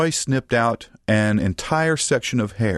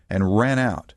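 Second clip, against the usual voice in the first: ordinary delivery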